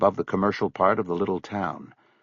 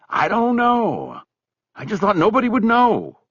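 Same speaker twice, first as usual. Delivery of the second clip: guilty friend; 4 dB louder than usual. Acted. Buddy's raised character voice.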